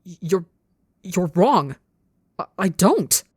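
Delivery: stammering, spasming protest; panicked defence. A narrator's reading. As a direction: force it out in jerky, faltering bursts. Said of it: "defends too fast and spasming".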